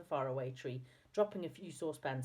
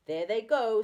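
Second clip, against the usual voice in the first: loud, higher gleeful exclamation; delighted; 5 semitones higher, 10 dB louder than usual. A narrator's reading. Moonface watching the pair tumble down, delighted. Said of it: "said Moonface in delight".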